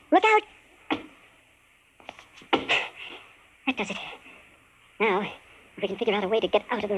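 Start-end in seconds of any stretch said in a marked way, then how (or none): 0.1-0.4 sharp, high, loud cry; warning shout. Acted. Man warning his friend sliding down the cord, loud high shout. 2.5-4.0 slow, breathless whisper through effort; strained whisper. Acted. Prisoner forcing the cage lock, slow strained whisper. Landing after the cord climb.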